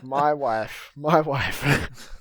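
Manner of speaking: loud, high cry of disbelief; mock outraged shout. Drunk husband hearing about his wife, mock outraged shout. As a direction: belt it out as a hammed-up wronged husband.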